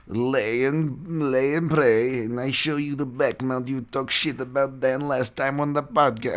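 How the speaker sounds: high, mocking impression of a fighter; Tybura impression. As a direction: lift the voice up and mock him. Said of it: "I know you don't sound like that".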